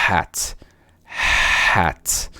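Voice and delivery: HAT with a short open vowel and crisp final T; teacher modelling the word HAT; English hat. Shown as a demonstration. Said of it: "a quarta palavra, como eu falei, é".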